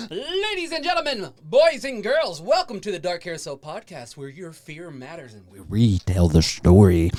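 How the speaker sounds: big showman announcer welcome. Acted.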